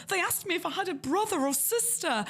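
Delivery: very high put-on mock complaint; mimicked fretful candidate. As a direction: imitate another overwrought interviewee. Another candidate coming out, mimicked.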